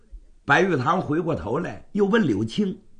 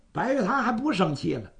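plain reading voice

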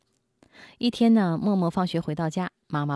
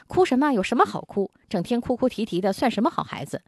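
impatient scolding mother; 7 semitones higher than usual. Retold or imitated. Mother scolding her crying son, impatient and sharp. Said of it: "而且责骂他".

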